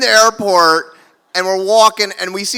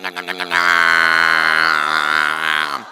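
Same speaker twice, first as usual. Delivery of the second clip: mock gobbling sounds; much slower than usual. Brother swallowing the drugs.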